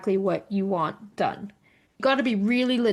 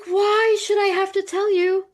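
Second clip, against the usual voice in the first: high-pitched retold protest; mock exasperation, pitched way up; exasperated; 10 semitones higher, 3 dB louder than usual. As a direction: throw the question back, indignant. Retells arguing with family.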